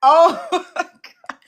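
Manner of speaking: real laugh breaking through the words, drawn out slowly; laughing in disbelief. Real, not acted. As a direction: laugh it out, caught off guard. Surprised by her old video.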